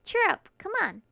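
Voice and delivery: upbeat. Acted. Max cheering up friend.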